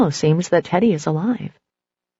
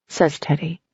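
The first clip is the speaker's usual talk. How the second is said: ordinary delivery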